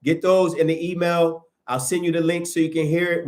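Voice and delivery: normal talking voice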